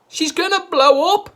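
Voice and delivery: high, loud wail of panic; panicked wail. A narrator's reading. Missus Cranky panicking over Grandma, high and loud. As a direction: wail it in fright. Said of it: "Missus Cranky wailed".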